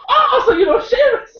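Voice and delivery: high-pitched mock burst of realization; hammed-up retort, pitch shooting way up; mock exclamation. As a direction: blurt it out high, playing up the punchline. Acting out own retort to stranger.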